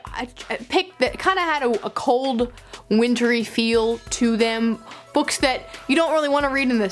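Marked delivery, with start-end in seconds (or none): none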